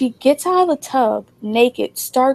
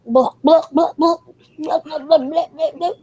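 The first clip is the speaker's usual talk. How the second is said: repeated mock bubbling splutter; imitated drowning noises; 5 semitones higher than usual. Friend drowning in a shallow bath, imitated spluttering. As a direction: imitate someone going under, higher as it goes. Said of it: "This girl is drowning in a shallow bath".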